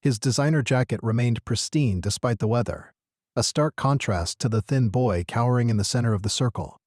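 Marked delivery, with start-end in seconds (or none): none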